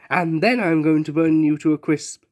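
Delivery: usual speaking voice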